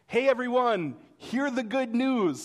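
high, loud mock announcement; herald's proclamation. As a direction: call out news to the whole town. Acting a herald announcing birthday news.

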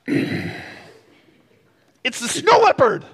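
very high, mock-outraged child's yell; slow, high-pitched kiddie outburst; exasperated young niece. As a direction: imitate a little kid indignantly correcting someone. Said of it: "And she'll go".

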